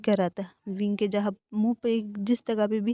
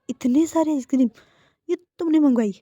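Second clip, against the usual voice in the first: astonished; 5 semitones higher, 7 dB louder than usual. Acted. Boyfriend sees table full of ice cream.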